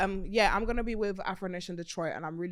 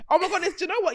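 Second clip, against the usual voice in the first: usual speaking voice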